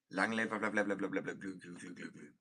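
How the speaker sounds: nonsense babble fading away; mock attempt at an unpronounceable word; made-up gibberish. Shown as a demonstration. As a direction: start loud, then tail off into repeated babbling syllables. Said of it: "just make up some random goo goo gaga gaga shit".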